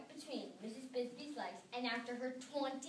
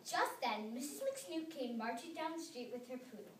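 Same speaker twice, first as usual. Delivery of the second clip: ordinary delivery, nothing marked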